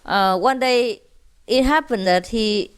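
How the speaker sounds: ordinary talk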